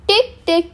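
high, crisp ticks; ticking sound. A narrator's reading. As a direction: tap out a tiny hammer. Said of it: "it went".

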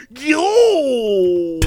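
loud, high drawn-out yowl; mock disgusted wail. Customer tasting his first espresso, mock disgusted wail. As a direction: recoil from a bitter taste with a yowl.

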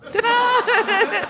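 high-pitched ta-da; a little fanfare to present the handbook. Pretend. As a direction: sing out a high ta-da. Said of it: "introduce a handbook with a da-da".

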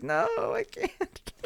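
very high, slow frightened squeak; scared squeak. Retold or imitated. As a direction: squeal it like the movie scared you. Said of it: "and I was like".

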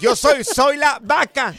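slow, loud put-on wife voice; mock proud announcement. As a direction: stretch it out, showing off the new name. Imitating the wife, joke voice. (voz burlona de señora, lenta y fuerte)

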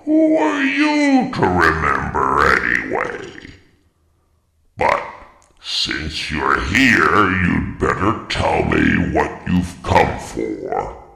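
slow, grumpy wizard voice; irritable, drawn-out sorcerer; impatient old wizard stretching his words; testy wizard. A narrator's reading. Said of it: "replied the wizard testily".

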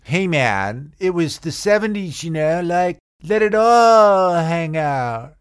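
slow laid-back hippie drawl; seventies hippie. Acted. Comedian evokes seventies roommate, slow hippie drawl. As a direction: stretch it out like a hippie.

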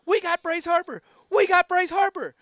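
imitating a fan yelling across the stands; high, loud shout of big news; excited yell. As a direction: holler it out to the whole crowd. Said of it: "some guy stands up out of nowhere and yells".

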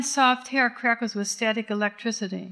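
ordinary delivery

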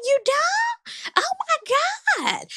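very high voice, laughing through it; mock disbelief. Host reacts to Candace not caring, high laughing disbelief.

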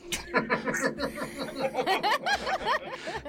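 high, breathy burst of laughter at his own pun; laughing at own joke. Real, not acted. Player cracking up at his own pun, laughing hard.

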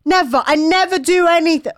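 very high, indignant sarcasm; mock teenage tantrum. Teenager challenging parents about cleaning, mock tantrum. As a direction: throw their words back in a mock strop. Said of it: "bad tantrums as a teenager".

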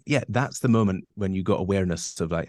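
ordinary talk, nothing marked

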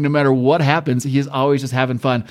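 usual speaking voice

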